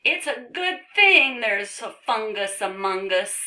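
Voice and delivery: jokey book character. Acted. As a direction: deliver the pun in a cartoon voice. Book character makes a pun.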